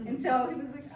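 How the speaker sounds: ordinary talk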